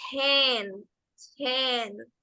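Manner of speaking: Ten, with a clear puff of air before the E; aspirated English T. Shown as a demonstration. Teacher repeating the model for the class, aspirated Ten. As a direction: say Ten with an airy T. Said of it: "Con aire antes de esa E".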